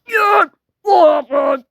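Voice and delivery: high, loud mock shout; pretend shouting. Demanding what happened in pretend play, high shout. Acted outburst in play scene.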